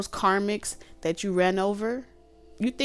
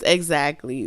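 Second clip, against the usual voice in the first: normal talking voice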